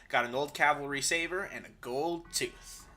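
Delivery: ordinary talk, nothing marked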